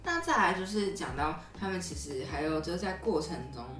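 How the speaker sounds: ordinary talk, nothing marked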